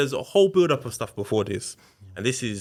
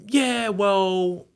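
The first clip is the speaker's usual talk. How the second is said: defiant retort; 10 semitones higher, 4 dB louder than usual. Retold or imitated. Partner's retorting quote.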